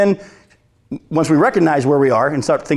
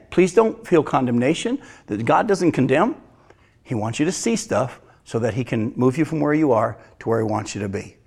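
ordinary delivery

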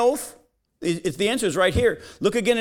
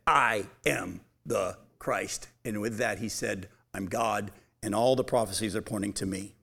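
a hushed, weighty declaration; low solemn confession; 10 semitones lower, 6 dB quieter than usual. Acted. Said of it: "Jesus under oath said".

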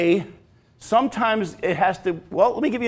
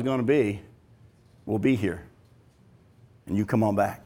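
tender welcome; 11 semitones lower, 7 dB quieter than usual. Acted. God voiced welcoming the sinner home.

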